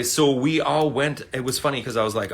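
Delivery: normal talking voice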